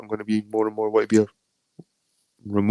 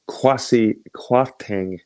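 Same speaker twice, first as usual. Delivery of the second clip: drawn-out, disbelieving name; slow scornful naming; much slower than usual. Real, not acted. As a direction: spell the name out slowly in disdain. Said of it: "who the fuck is this guy?".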